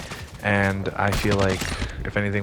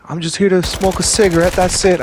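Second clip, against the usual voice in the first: lightly voiced higher, louder retort; mimicked protest; 8 semitones higher, 5 dB louder than usual. Celebrity objecting to the guard, mimicked protest. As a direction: mimic an indignant smoker, lightly.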